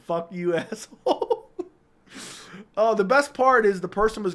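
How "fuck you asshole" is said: slow, laughing through the quote. Real, not acted. Retold note insult, laughing. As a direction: chuckle the insult out.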